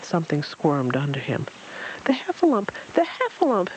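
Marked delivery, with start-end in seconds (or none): 2.0-3.7 very high squeal of alarm; Piglet. A narrator's reading. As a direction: squeal it out twice, far up in pitch. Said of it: "Piglet squealed".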